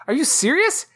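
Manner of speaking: ordinary talk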